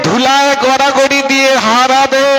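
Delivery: high, sustained declamatory cry; impassioned preaching. A narrator's reading. Preacher telling devotees of Mahaprabhu weeping in the dust, impassioned. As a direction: preach it out high, drawing out the phrase endings.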